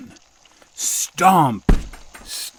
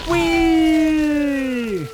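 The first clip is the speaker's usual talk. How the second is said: high whee. Acted. Giant flying through the air.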